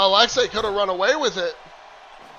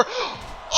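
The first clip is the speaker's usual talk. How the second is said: sharp gasp; 12 semitones higher, 11 dB quieter than usual. Real, not acted. Gasp before the big move.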